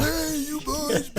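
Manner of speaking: put-on high, soft and slow "Hey, you boys"; high soft greeting. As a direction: drawl it high and quiet. Repeats greeting softer, slow.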